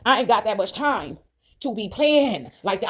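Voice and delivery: slow, drawn-out insistence; slow emphatic repeat. Real, not acted. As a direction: stretch TIME and PLAYING out slowly.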